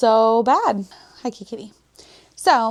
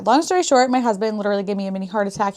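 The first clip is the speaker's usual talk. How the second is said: usual speaking voice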